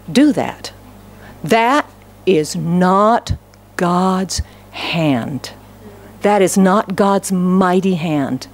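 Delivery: usual speaking voice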